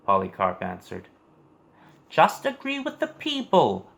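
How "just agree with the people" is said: much higher, pleading voice of the proconsul; begging proconsul. A narrator's reading. Proconsul begging old Polycarp to give in, high pleading voice. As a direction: plead with him in a high, strained voice. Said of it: "the proconsul begged".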